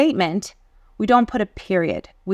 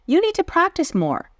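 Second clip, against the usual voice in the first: gym teacher voice; 10 semitones higher than usual. Acted. Quoted gym teacher line.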